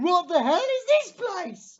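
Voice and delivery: slow, high and loud brassy exclamation; Catherine Tate. Retold or imitated. Quoting the companion in the TV episode, slow high loud exclamation. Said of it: "Catherine Tate's standing there ... going".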